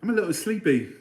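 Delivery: amateur English Northern accent. Shown as a demonstration. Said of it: "That's English Northern".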